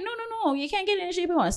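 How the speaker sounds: high, rapid mock dismissal; dismissive refusal. Salon boss refusing a client's request, high quick dismissal.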